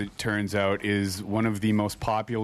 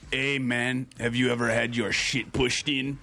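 movie thug quote. Retold or imitated. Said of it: "that's a scene from".

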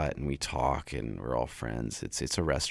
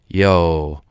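louder, a calling "Yo"; retold call-out; 10 dB louder than usual. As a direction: shout it like a fan in a crowd. Crowd member calling out excitedly.

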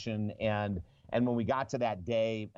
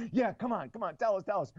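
high, eager pleading; eager type A's; 8 semitones higher than usual. Retold or imitated. Audience imitating type A's begging, high and eager. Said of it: "they're going".

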